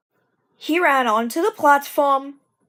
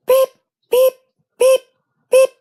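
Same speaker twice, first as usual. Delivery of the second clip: short, sharp repeated toots; four quick whistle beeps; impatient engine toots; 14 semitones higher, 8 dB louder than usual. Acted. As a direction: toot again and again, waiting.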